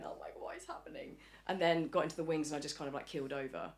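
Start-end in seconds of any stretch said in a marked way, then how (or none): 0.0-1.2 whispered, breathy-soft alarm; retold panic. Performer recalls struggling in the finale, whispered panic. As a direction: whisper it as if failing mid-performance.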